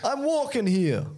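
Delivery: high, drawn-out mock New York holler; exaggerated New Yorker protest; New York accent. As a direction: ham up a New York accent, slow and indignant. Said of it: "I think it's New York".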